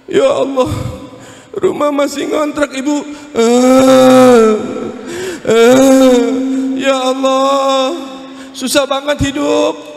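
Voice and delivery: high, wailing plea with drawn-out sobs; mock crying prayer. Shown as a demonstration. Preacher showing couple how to cry to God over hardship, mock wailing. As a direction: demonstrate a tearful, hammed-up prayer. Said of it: "Kalau doa pakai nangis".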